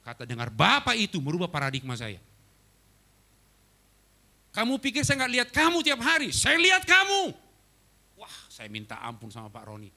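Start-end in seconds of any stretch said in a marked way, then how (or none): 4.5-7.4 high, loud scolding, slowing on the last words; stern rebuke. Retold or imitated. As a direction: scold him like a hurt father. Senior pastor scolding him.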